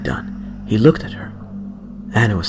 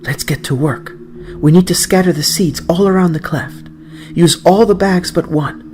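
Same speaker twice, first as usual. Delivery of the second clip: higher, louder grandmother giving quick orders; an excited old woman hurrying the boy along; eager grandmother; 7 semitones higher, 4 dB louder than usual. Acted.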